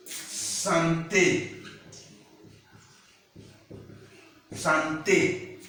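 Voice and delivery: "Santé" syllable by syllable, san-tay; final é demonstrated. Shown as a demonstration. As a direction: model "Santé" with a clear final "ay".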